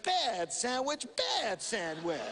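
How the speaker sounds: slow, soft mock scolding; hushed, slow mock reprimand with a laugh at the end; scolding a bad dog. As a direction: tut at the sandwich like a naughty puppy. Attendant scolds the sandwich.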